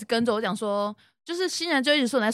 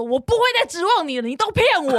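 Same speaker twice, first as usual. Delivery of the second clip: re-enacted high-pitched outburst at a coworker; retold angry outburst; 6 semitones higher than usual. Retelling a fed-up outburst at Monica while cleaning, raised voice. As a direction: replay losing your temper, pitch jumping up. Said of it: "我就这样放很大声说".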